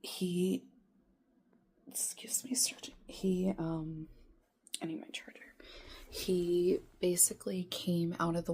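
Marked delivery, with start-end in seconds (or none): 1.9-2.9 hushed aside to someone off-mic while searching; quick off-mic whisper. Real, not acted. 4.8-5.4 whispered aside. Real, not acted. Whispered aside about charger.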